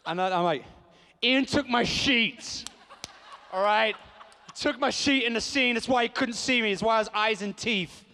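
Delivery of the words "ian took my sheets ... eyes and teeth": high, loud mock outrage; hammed-up indignant shouting; acted loud protest. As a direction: protest at full voice, comically aggrieved. Acted loud protest over stolen light.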